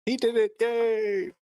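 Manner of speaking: high delighted cheering into a laugh; excited cheer. Real, not acted. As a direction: cheer like your friend just won. GM cheers the accent attempt.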